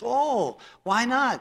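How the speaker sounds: high, startled wife asking why; surprised wife. Retold or imitated. The wife, told to skip temple, asks high and startled. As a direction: pitch up high as the puzzled wife. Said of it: "She goes".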